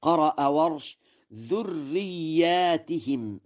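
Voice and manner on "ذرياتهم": slow, higher "ذرياتهم" with long aa and -ihim ending; plural with long alif. Shown as a demonstration. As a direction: say "ذرياتهم" as dhurriyyaatihim. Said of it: "بألف بعد الياء على الجمع".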